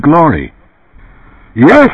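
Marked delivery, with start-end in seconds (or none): 1.5-1.9 high emphatic advert exclamation; dark-voiced pitchman. A narrator's reading. Runsider resuming his TV commercial, emphatic pitchman. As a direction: burst back into the sales pitch. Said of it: "Runsider's dark voice resumed".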